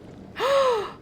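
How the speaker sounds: sudden frightened intake of breath; sharp high gasp. Real, not acted. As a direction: gasp in shock. Sudden scare in game.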